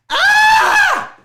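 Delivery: piercing high-pitched scream; loud scream. Shown as a demonstration. Showing listeners the scream heard in a dream, loud scream. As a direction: scream it out suddenly at full volume. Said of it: "this is gonna be loud".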